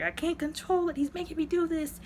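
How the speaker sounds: high-pitched imitation of pleading; mock-distressed victim's cry; retold helpless plea. Retold plea of controlled widow.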